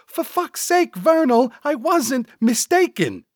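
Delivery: high, loud sidekick outburst; exasperated. Acted. Cecil argues back exasperated.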